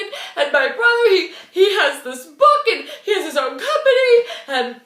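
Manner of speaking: high, hammed-up blubbering wail; mock sobbing. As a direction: imitate a grown man bawling out his woes. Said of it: "and he's sobbing".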